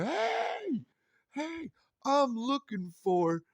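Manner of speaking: put-on high, slow excited child's voice; kid voice. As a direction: sing it out like a bouncy little boy. Kid voice reimagined.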